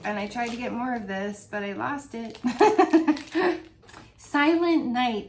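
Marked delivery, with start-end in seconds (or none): 2.4-3.7 bright, loud burst of laughter; rueful laugh; high laugh. Real, not acted. Laughing at losing the order.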